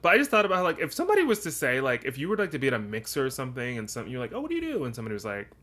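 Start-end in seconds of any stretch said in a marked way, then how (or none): 4.3-4.8 chirpy small talk. Pretend. Mock mixer small talk.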